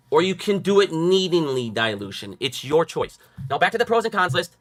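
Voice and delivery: ordinary talk, nothing marked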